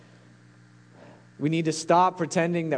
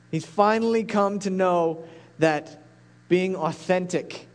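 usual speaking voice

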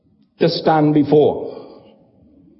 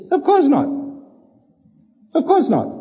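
high, emphatic "of course not"; obvious answer; 9 semitones higher than usual. Acted. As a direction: say it as if the answer is self-evident. The implied answer to rhetorical questions.

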